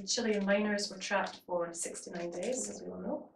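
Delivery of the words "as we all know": usual speaking voice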